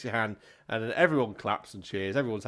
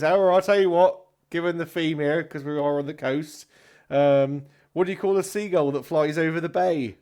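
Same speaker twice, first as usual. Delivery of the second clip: loud, higher stage patter; hammy pub comedian; 6 semitones higher, 9 dB louder than usual. Acted. Comedian sharing a seagull joke with the pub, showy. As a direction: set up the gag for the whole room.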